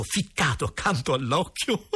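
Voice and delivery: words breaking into high giggles; laughing. Acted. Boy narrator unable to help laughing at Maralli, giggling. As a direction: try to tell it, dissolve into laughter. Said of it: "non potei trattenermi dal ridere".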